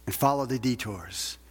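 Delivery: ordinary talk, nothing marked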